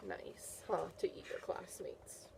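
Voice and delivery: soft unvoiced aside; hushed gentle reproach; whisper. Real, not acted. Teacher aside to children.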